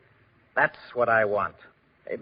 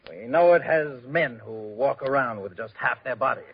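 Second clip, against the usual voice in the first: ordinary delivery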